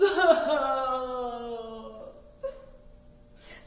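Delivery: loud hammed-up fake sob; playful fake boo-hoo; mock crying wail. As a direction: pretend to bawl for the kids. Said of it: "cry down to our toes".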